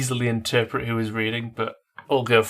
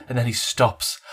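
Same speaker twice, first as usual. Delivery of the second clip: ordinary talk, nothing marked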